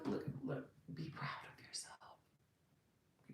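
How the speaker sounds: soft unvoiced whisper. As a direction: lean in and whisper it like a secret. Intimate repeated encouragement to viewers.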